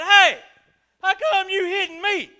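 high, loud, indignant donkey voice; a donkey protesting his beating, loud and high; talking donkey. Retold or imitated. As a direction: squawk it like a cartoon donkey. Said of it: "I imagine he did it in that voice, like on Shrek".

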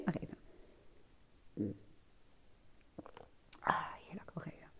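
mid-sip, whispered sigh of pleasure; while sipping a drink. Real, not acted. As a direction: talk between sips of coffee. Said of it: "ik ga eerst, eerst even een slokje nemen".

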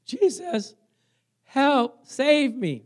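high, loud plea for rescue; loud desperate cry. Acted. Preacher as sinking Peter crying to Jesus, loud high plea. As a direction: cry out like you're going under. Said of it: "Peter cried with a loud voice when he was sinking".

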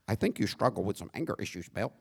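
a hint of a prim suburban husband, fast and soft.

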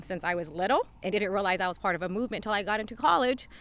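ordinary talk, nothing marked

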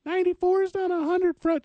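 very high-pitched silly correction; mock nitpicking voice. Host mimics a nitpicker about the score, mock high voice. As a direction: squeak it out like a fussy nitpicker.

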